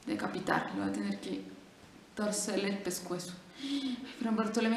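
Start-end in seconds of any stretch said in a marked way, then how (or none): none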